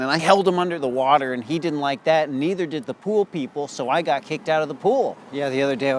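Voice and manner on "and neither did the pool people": ordinary talk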